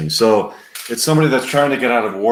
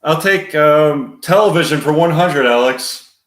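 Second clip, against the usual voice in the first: raised, projected contestant voice; game show contestant; 5 semitones higher, 4 dB louder than usual. Acted. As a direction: pick a category for the host. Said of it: "Scene two, contestant".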